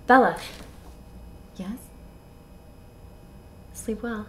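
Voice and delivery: tender goodnight. Acted. Acts a hushed goodnight exchange.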